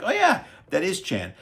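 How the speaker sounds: high, delighted recognition; recognizing. Retold or imitated. Reviewer quoting himself spotting Jackie Chan, delighted. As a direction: spot him and light up. I'm like.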